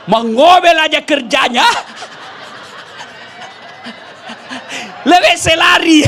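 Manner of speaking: a jinn cracking up as it complains; laughing jinn. Retold or imitated. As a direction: jinn guardian of the well complaining through laughter.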